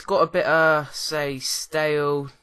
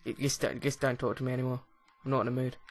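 softer, fed-up mock sulk; 2 semitones lower, 6 dB quieter, faster than usual. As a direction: wave it off sulkily. Sulking end of fake fight.

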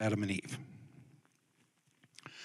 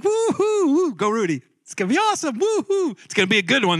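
much higher, hammed-up forced whooping; mock a pastor faking joy with cheers; fake hype cheer; 10 semitones higher, faster than usual. Preacher mocking fake joy with hammed-up cheers. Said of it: "it's a fake joy".

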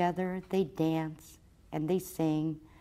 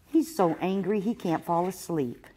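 plain reading voice

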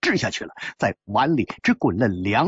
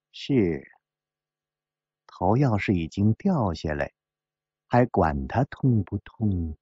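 coldly; 6 semitones lower than usual. Acted. Woman to gambler about his severed head, coldly. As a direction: keep it low and cold, slowing at the close. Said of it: "牛肉汤冷冷地说".

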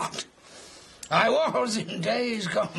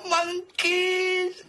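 laughing. Acted. Erupts at the monkey idea.

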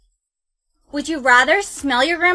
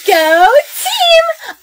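loud high cheer; 11 semitones higher, 5 dB louder than usual. Acted. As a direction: cheer like from the stands. Cheering at the game.

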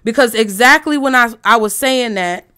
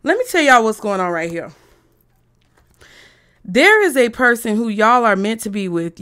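normal talking voice

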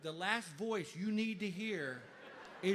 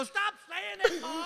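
high, loud comic plea; hammed-up exasperation at mom; exasperated son; 8 semitones higher, 8 dB louder than usual. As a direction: cry out in desperate protest. Stoned son begs mom to stop.

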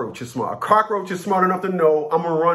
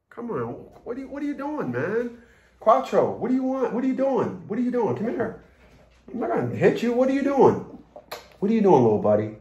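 higher, coaxing pet voice; talking to the dog; 6 semitones higher than usual. Real, not acted. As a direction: coax the dog to come over. Calling his dog.

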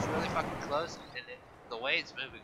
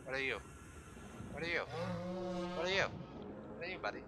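cooing to creature; 3 semitones higher, 9 dB quieter, much faster than usual. Acted.

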